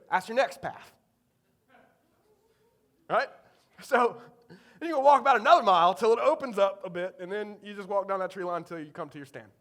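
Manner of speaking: brisk Eastern North Carolina hunter impression; thick Eastern North Carolina accent. Dad's friend sends teenager to the stand, Eastern North Carolina accent. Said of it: "he's got a thick Eastern North Carolina accent".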